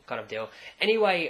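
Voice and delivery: ordinary talk, nothing marked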